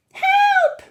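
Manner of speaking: very high, loud scream; shrill cry for help. A narrator's reading. Mrs. Jenkins panics at the mouse, screaming. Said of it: "screamed Missus Jenkins".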